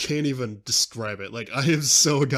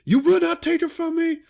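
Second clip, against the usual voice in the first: hammed-up solemn Jedi, pitched way up; imitating Obi-Wan; 6 dB louder than usual. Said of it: "Obi-Wan's like".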